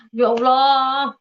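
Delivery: loud, drawn-out cry to God; retold tearful cry. Speaker retells crying behind the house, anguished cry to God. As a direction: call out to God, stretching the words. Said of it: "saya nangis".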